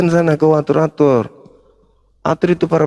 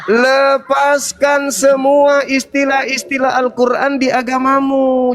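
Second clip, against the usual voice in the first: higher, slow and condescending; mocking lecture; 8 semitones higher, slower than usual. Real, not acted. Lecturing the caller on terms.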